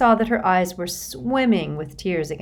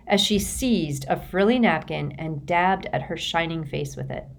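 plain reading voice

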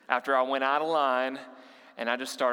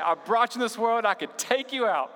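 higher, louder parent's threat; stern parent; 7 semitones higher, 4 dB louder than usual. Retold or imitated. Pastor imitating a parent warning a child, mock stern. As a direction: deliver the classic parent warning. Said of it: "Everybody, you finish it".

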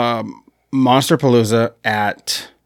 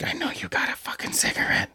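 imitating a rough whisper, much softer and hurried; harsh whisper; 12 dB quieter, faster than usual. Actor pulls him close demanding a cigarette, in a fast harsh whisper. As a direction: hiss it right into his face, fast and voiceless.

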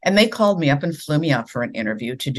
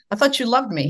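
higher, hammed-up wounded whine; mock hurt; 8 semitones higher, faster than usual. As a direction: pretend to be betrayed.

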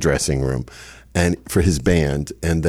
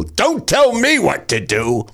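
a higher, slow mock of the mother; mother's voice; 9 semitones higher, slower than usual. Mother brushing off her child, mock snappish. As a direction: snap back as the dismissive mother.

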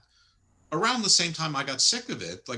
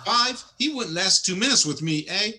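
mock Canadian accent, louder and higher, with a laugh; hammed-up Canadian hoser, eh; 5 semitones higher, 6 dB louder than usual. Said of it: "And Kenny Omega goes".

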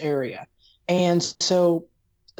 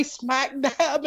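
high, stretched Southern drawl; milk each word with a slow Southern twang; Southern accent; 8 semitones higher than usual. Retold or imitated. Showing how one phrase gives a Southerner away, in a Southern accent. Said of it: "she's from the South".

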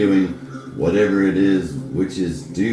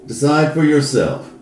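louder, higher spooky warning; ominous warning; 6 semitones higher, 6 dB louder than usual. Acted. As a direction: warn them with rising menace. Spooky warning, building loud.

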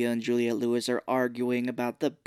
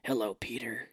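Batman type voice; 2 semitones lower, 7 dB quieter than usual. Retold or imitated. Imitating Doctor Strange's American accent, Batman type voice. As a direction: whisper it like Batman. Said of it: "kind of like a Batman type voice".

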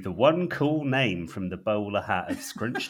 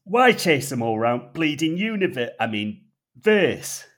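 louder, higher North Yorkshire grumble; North Yorkshire accent; 6 semitones higher, 10 dB louder than usual. Acted. Jizz mopper challenging the operative, North Yorkshire accent. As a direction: bark it like a gruff Yorkshire cleaner. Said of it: "in his gruff North Yorkshire tone".

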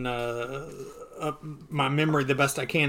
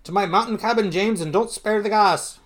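really bad Scottish accent, higher and louder; Scrooge in a bad accent; 5 semitones higher, 5 dB louder than usual. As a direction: play a grumbling miser with a hammed-up Scottish burr. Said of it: "Uncle Scrooge says".

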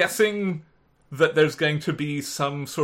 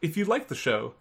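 soft, steady outro read; podcast outro read; 5 dB quieter than usual. Acted. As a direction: read the credits calmly.

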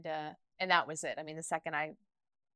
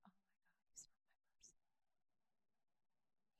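whispered realization; 40 dB quieter, much faster than usual. Retold or imitated. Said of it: "right then I was like".

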